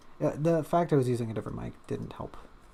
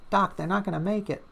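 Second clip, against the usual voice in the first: higher-pitched mock hospital drama in a Brooklyn accent; 7 semitones higher than usual. Pretend hospital emergency, pleading to the doc in a Brooklyn accent. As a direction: play a melodramatic Brooklyn plea to the doctor. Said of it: "I'm at a hospital in, in Brooklyn".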